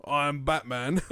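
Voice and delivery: lightly put-on British accent; a hint of London, jokey Batman; mock British accent. Friend jokes about a British Batman in a mock British accent. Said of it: "I was gonna do an accent".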